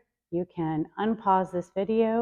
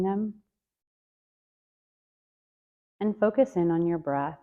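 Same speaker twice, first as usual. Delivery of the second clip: guided meditation. Acted. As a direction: ease them inward, gently and unhurried. Leads a breath meditation.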